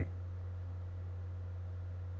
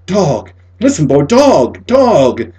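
crying out; 7 semitones higher, 12 dB louder than usual. A narrator's reading. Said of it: "Martin cried out".

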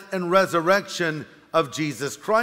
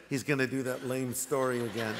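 muttered aside; 5 semitones lower, 10 dB quieter than usual. Retold or imitated. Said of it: "you leaned over and you said".

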